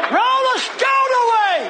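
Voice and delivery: very high, drawn-out preaching cry; commanding shout. Acted. Jesus's command at the tomb, commanding shout. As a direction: shout the command slowly at peak pitch.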